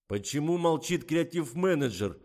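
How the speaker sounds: plain reading voice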